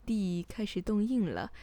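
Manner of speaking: plain reading voice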